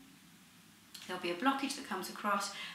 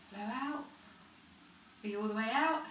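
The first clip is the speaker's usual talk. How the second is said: normal talking voice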